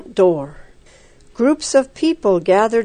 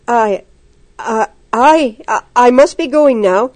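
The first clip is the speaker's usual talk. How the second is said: nervous stammer, rising louder; 4 dB louder than usual. A narrator's reading. Frightened girl stammers her excuse to leave the sickroom, voice rising. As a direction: trip over each I as panic builds. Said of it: "she stammered".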